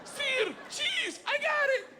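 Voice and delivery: very high, thrilled shout; eager pupil. Acted. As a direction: beg eagerly for the next challenge. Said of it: "And I was like".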